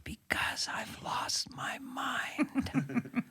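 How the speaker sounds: slow, breathy whisper of a confession; Biden whispering. Retold or imitated. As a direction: whisper it as if confessing to losing your mind. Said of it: "Joe Biden's still whispering".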